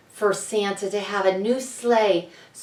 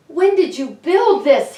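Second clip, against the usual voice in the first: higher, louder amazed question; 6 semitones higher, 5 dB louder than usual. A narrator's reading. Santa marvels at the sleigh the elves built, excited. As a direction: marvel at it out loud.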